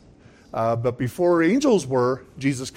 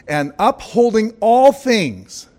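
"all things" drawn out high and loud; ringing, slow emphasis on "all things"; emphatic stress; 6 semitones higher, 5 dB louder, slower than usual. Real, not acted. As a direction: proclaim it slowly, leaning hard on "all things". Preacher stresses verse's key words.

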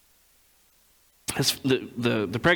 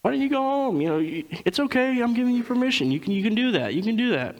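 high, overly casual cover-up voice; playing it off; 9 semitones higher than usual. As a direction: fake breezy coaxing, voice pushed way up. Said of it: "he's trying to play it off".

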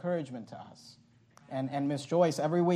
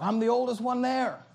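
higher, joking impression of dad; 8 semitones higher, 4 dB louder than usual. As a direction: play dad teasing about his age. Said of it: "he'll joke".

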